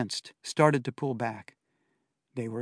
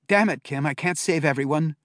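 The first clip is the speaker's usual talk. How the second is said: frustrated outburst; 4 semitones higher, 6 dB louder than usual. A narrator's reading.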